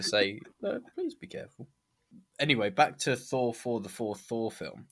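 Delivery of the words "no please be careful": high, soft put-on pleading voice; a fretful little voice begging caution; mock plea. Podcaster mocks a worried voice, high and soft.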